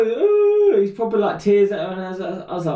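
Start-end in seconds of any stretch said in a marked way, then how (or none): 0.1-0.8 put-on high loud kid howl; loud mock bawl; crying child wail. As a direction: wail along in sympathy. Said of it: "He starts giving it some".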